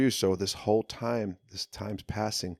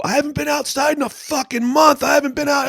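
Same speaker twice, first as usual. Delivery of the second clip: much higher, loud indignant yell; outraged shout at the guard; retold outburst; 12 dB louder than usual. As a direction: bellow it like you can't believe it.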